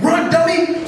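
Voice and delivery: high urgent cry; exasperated urging. Acted. Mice urging their escaped friend onward, high exasperated cry. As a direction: urge him on with the voice shooting way up. Mice urge frozen hero to flee.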